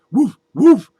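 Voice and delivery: high, loud barking yip; dog barking. Acted. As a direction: bark like a dog hiding in a sack. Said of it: "the redhead says".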